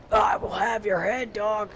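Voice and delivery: shouted cheesy bandit threat. Retold or imitated. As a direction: yell the villain line like a generic bandit. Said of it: "he turns into a generic bandit".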